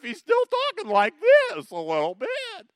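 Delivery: squeaky, very high mock boy voice; unbroken boy's voice. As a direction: pipe up loudly like a boy before puberty. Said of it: "if David's voice has changed yet".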